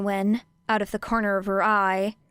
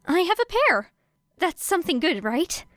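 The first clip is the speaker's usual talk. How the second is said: bright, forced chirpy voice; forced cheer; 9 semitones higher than usual. A narrator's reading. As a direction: fake cheerfulness through gritted teeth. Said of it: "forcing herself to sound cheerful".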